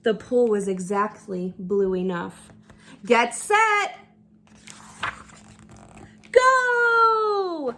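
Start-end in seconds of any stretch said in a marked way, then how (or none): 3.0-7.7 high, loud starting call; starter's shout. Acted. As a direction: shout the start across the pool.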